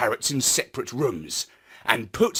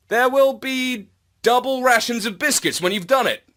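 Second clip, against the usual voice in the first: higher, coaxing offer; Marlowe promising treats; 8 semitones higher, 3 dB louder than usual. A narrator's reading. Marlowe bribing the robo-dogs with biscuits, higher and coaxing. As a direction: tempt the dogs, pitched up.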